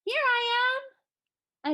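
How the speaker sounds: very high, held, sing-out answer; tiny magic ring calling back clearly. A narrator's reading. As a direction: pipe up on one high note. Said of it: "answered in a high, clear voice".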